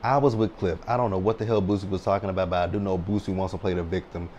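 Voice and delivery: ordinary talk, nothing marked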